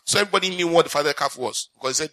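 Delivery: ordinary delivery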